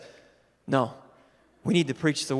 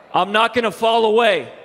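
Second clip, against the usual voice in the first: louder, higher, firm declaration; declared; 7 semitones higher, 7 dB louder than usual. Shown as a demonstration. Pastor declares the vow loudly to the congregation. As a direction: proclaim it boldly. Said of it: "I need you guys to say something".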